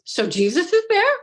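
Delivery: high-pitched retold question, rising at the end; imitated questioner. Imitating the session guide asking the client, innocent high query. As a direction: lift it high as a naive question. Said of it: "So she then goes".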